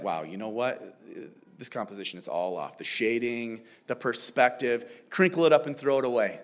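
low, deadpan mock critique; mock critic. Parent pretending to critique a child's drawing, low and deadpan. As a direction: soberly pick it apart, pretending. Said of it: "you don't take that picture and be like".

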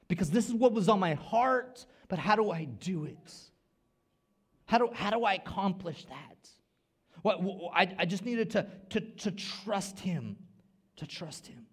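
loud, high, urgent; impassioned preaching. Acted. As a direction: build the preaching climax. Preaching climax.

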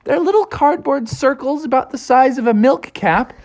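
lightly voiced eager beaver; Bucky explaining. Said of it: "Bucky was like".

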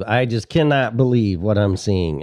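usual speaking voice

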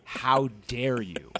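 loud hammed-up indignation, easing at the end; mock outrage. Panelist defending a singer to Matt, mock outrage. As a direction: play at being scandalised.

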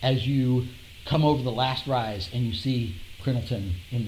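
low, dramatic scene setting; game master narration. Acted. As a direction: paint the scene slowly, ominously.

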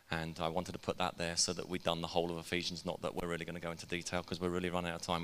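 rapid-fire, rushing through the words; auctioneer speed. Real, not acted. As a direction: rattle it off like an auctioneer. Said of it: "which is why I'm talking like an auctioneer".

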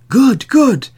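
loud, high double cheer; crying out approval. A narrator's reading. Townsmen cheering the wise man's plan, high and loud. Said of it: "cried the others".

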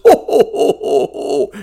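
high loud Santa laugh fading off; belly-laugh like Santa; jolly ho ho ho. Acted.

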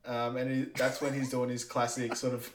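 ordinary delivery

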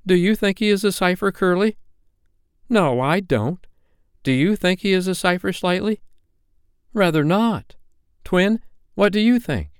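put-on high, piping boy's voice polling his friends; loyal young boy. A narrator's reading. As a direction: quiz the other boys in a high child voice.

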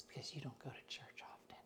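whispered scolding in the pew; hiss-whisper an argument so nobody hears; hushed church argument. Retold or imitated.

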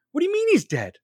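high-pitched fake disbelief; Vladimir Putin. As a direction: play shocked, voice leaping up high. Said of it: "Like, Putin's in shock".

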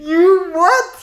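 very high, loud scandalised squawk; mock outrage. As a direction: demand it in shrieking disbelief. Feigned shock at bootleg confession.

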